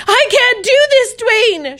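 very high, loud panicked cry; terrified scream. A narrator's reading. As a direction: scream it before jumping off. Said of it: "she screamed".

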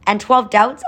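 high, strained call over rushing water; narrator's restrained shout, pitched well up; mock shout. A narrator's reading. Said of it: "Oscar shouted over the crashing of the water".

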